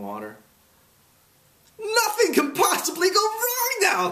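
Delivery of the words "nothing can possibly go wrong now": very high, drawn-out gleeful character voice; happy cry. A narrator's reading. Humbug sighting a beautiful island, crying out happily. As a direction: cry it out high and slow with glee. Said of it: "cried the humbug happily".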